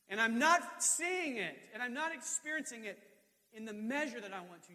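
high, loud full-force sermon; impassioned preaching. Real, not acted. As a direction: cry out a complaint to God from the pulpit.

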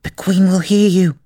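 high, nervous warning; anxious high-pitched stage whisper; frightened whisper. A narrator's reading. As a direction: warn them fearfully in a small voice. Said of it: "whispered in a frightened tone".

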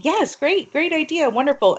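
high, gushing mock praise; overly enthusiastic. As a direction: gush high over the idea. Nonprofit humoring eager volunteers.

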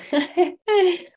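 high, loud burst of happy laughter; delighted laugh. Real, not acted. Mother laughing about her miracle child, high and loud.

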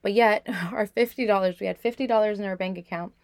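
usual speaking voice

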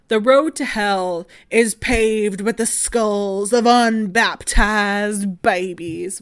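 slow, loud Southern drawl; Southern-accented quote, heavy and drawn out. Acted. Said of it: "say that in a Southern accent".

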